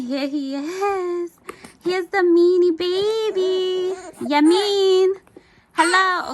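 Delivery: high, loud, drawn-out cooing. Real, not acted. Mother showing her baby to viewers, cooing baby talk.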